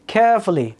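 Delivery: carefully with a strong care, then falling; first-syllable stress. Shown as a demonstration. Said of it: "প্রথম syllable হলো". (carefully-র প্রথম সিলেবলে জোর)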